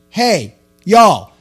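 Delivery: loud, high yell across the room; retold shout. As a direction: bark orders to throw him out. Yelling to friends at front.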